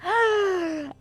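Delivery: high gasp then a squealing Eee and a laugh; excited squeal. Real, not acted. Streamer told she has a beautiful smile, squeals with delight. Flattered by a compliment from chat.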